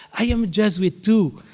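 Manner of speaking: mock-proud alumnus declaring belonging. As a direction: quote the alumni with a lift of pride. Alumni proudly claiming Jesuit identity.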